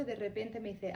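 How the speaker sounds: normal talking voice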